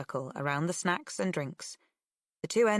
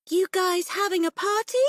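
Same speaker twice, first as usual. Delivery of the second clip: put-on creepy girl voice; 7 dB louder than usual. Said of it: "a girl's voice asks".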